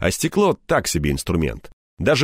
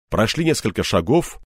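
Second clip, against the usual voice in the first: ordinary delivery, nothing marked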